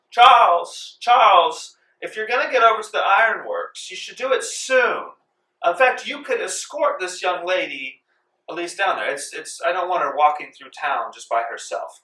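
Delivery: high, loud calling for her husband; yelling to the other room. Acted. The wife calls her husband from another room, high and loud. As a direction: call Charles through the house like a grand lady. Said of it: "she yells to the other room".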